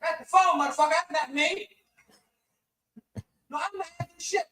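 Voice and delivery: put-on slurred, rambling drunk dad yelling at his wife; drunk father. Drunk father ranting at wife Lil about the phone, slurring.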